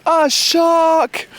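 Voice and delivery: shouted answer. Retold or imitated. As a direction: call it back across the lake. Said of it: "And I shouted".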